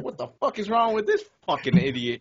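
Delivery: high-pitched indignant outburst; retold exasperation. Retelling his outburst at a jaywalker while driving, exasperated. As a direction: exclaim in mock outrage.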